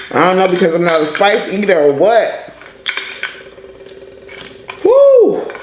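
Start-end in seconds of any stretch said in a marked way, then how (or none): 4.8-5.5 shrill yelp from the heat; high loud woo. Real, not acted. Burning from hot sauce.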